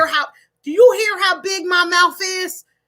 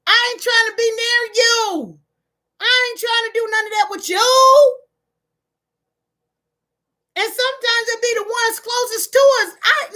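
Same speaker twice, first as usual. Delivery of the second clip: tearful outburst; 5 semitones higher than usual. Real, not acted. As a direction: voice rising into tears, high. Hurt by her own family.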